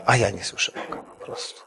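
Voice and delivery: barely voiced, almost whispered; hushed complaint. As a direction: whisper it like a sad confession. Son complains he can't hear God. (szeptem, smutno)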